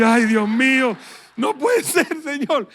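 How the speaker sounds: half-laughing complaint to the Lord; laughing plea. Retold or imitated. Pastor laughing while pretending to lament to God.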